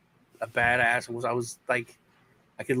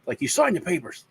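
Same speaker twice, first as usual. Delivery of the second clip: lightly voiced mom, pitched higher, uneasy; a hint of a hesitant mother, higher; mom's voice; 8 semitones higher than usual. Mom on the phone to son about the paddling, lightly voiced and higher. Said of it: "My mom's like".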